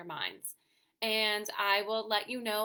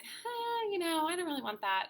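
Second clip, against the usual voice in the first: mock child voice, much higher and fast; picky kid; 8 semitones higher than usual. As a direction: imitate a fussy toddler turning food down. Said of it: "be like".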